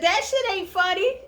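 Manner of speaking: light impression of an annoyed wife; imitating Hannah. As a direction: mimic her snapping it's not funny. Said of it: "That's what she said".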